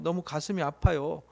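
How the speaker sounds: normal talking voice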